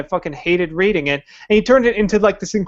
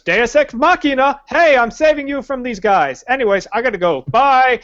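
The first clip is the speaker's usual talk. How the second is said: hammed-up wizard swooping in and out; offhand hero announcing himself; mock Gandalf; 7 semitones higher than usual. Mock Gandalf rescuing then leaving.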